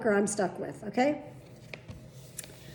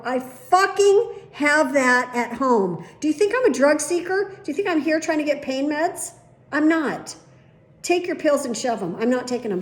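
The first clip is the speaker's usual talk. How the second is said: loud, higher angry rant; furious outburst; 4 semitones higher, 5 dB louder than usual. Retold or imitated. Patient yelling at the nurse over the pills, furious. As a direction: let her have it, furious.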